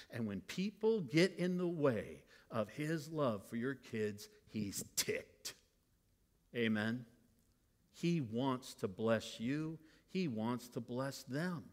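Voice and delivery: ordinary talk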